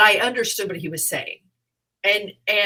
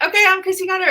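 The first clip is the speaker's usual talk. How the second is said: much higher, louder panicked rush; panicked sign-off; 12 semitones higher, 8 dB louder, much faster than usual. Retold or imitated. Bombing comic on stage, panicked hurried sign-off. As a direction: blurt it out and flee. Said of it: "literally panicked and went".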